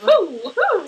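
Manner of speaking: loud high whoop; excited whoop. Real, not acted. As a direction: cheer it out loud. Cheering after a quip.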